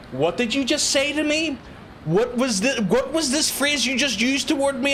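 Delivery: high-pitched mock bewilderment; baffled outsider. Outsider questioning strange Belfast slang, high mock bewilderment. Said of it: "if they're not from here, it's like".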